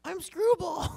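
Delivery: very high character squeak; high squeaky voice. Pretend. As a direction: pitch up into a squeaky toon. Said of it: "I'm here with Screwy".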